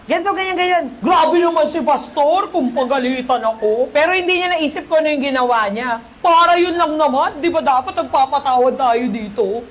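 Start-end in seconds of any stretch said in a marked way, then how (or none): 0.1-3.9 mock aggrieved grumbling; put-on whine of the wronged party; entitled complaint. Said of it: "Grabe, entitled talaga". 6.2-9.7 mock indignant protest, starting raised; put-on aggrieved grumbling; entitled complaint. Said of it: "Grabe, entitled talaga".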